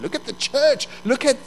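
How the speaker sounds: devil's accusations, building; devil's taunting. Acted. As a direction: taunt rising in pitch, punching the last question. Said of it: "the devil says".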